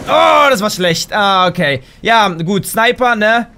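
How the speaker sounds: ordinary talk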